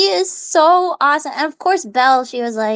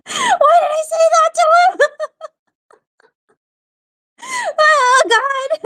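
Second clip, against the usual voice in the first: laughing cringe; slower than usual. Real, not acted. Embarrassed at what she told Gaston, laughing shout. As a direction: squeal it out, mortified and laughing. Cringing at her own reply.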